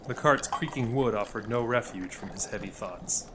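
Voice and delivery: ordinary delivery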